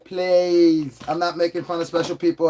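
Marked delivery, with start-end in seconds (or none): 0.0-1.0 slow, stretched-out plea; drawn-out movie-quote plea; movie impression. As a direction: imitate a film character begging, vowels drawn out. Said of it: "It's from the movie fucking called Fifth Element".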